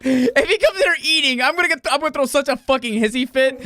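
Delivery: high, loud laughing tantrum; laughing rant. Real, not acted. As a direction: rant while cracking up. Laughing through anger.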